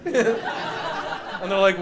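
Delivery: ordinary delivery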